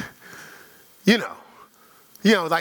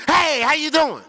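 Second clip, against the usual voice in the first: bright, much higher, louder hello; breezy greeting; 9 semitones higher, 3 dB louder than usual. Acted. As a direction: greet them cheerily, clueless of the grudge. Offended person greeted cheerfully.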